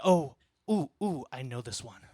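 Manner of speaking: imitating wife's sudden eager squeal; hammed-up sudden eagerness; mock excited. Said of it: "she gets very excited".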